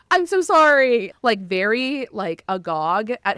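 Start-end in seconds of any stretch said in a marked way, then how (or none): 0.1-1.1 high, loud horrified sorry; shocked apology. Retold or imitated. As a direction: blurt it out, aghast. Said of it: "he goes".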